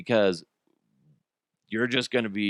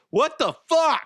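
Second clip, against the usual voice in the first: mock outrage; 4 dB louder than usual. Reacting to wife's punch.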